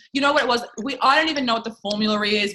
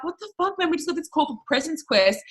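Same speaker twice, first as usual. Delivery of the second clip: shocked disbelief; 7 semitones higher than usual. Retold or imitated. Retold shocked call to upline.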